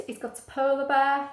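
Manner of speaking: usual speaking voice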